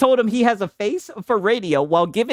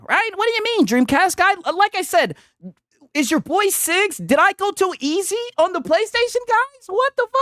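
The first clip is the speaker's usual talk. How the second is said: mock outrage; 11 semitones higher than usual. Mocking a shocked PlayStation fan.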